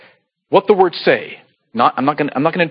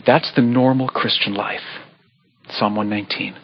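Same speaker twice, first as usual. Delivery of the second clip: ordinary talk, nothing marked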